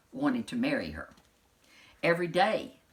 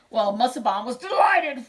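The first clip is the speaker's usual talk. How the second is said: excited; 4 semitones higher, 8 dB louder than usual. A narrator's reading. Narrator voices excited prince.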